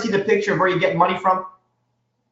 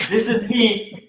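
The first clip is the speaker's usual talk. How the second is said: normal talking voice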